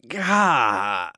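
loud, drawn-out "gah" of pain; attempted scream. A narrator's reading. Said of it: "I screamed. At least I tried to".

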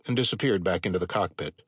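ordinary delivery

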